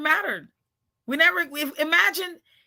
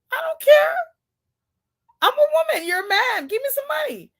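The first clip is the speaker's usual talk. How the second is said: much higher, loud brassy demand; bossy demand; 13 semitones higher, 4 dB louder than usual. Retold or imitated. Telling the homeless man to give her money, high and loud. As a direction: demand it like a diva. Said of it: "I said".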